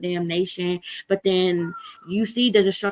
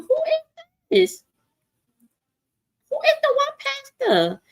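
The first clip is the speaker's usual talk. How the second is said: much higher, slow, incredulous squeak; mock squeaky disbelief; 4 dB louder than usual. Streamer rereading a viewer's name, squeaky mock disbelief. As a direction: act stunned by a viewer's name.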